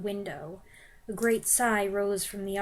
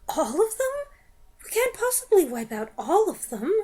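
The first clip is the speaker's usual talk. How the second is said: higher, startled objection; doubting witch; 8 semitones higher, 4 dB louder than usual. Acted. Front-row witch questioning the Grand High Witch, mildly alarmed. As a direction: blurt the doubt out. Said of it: "saying aloud".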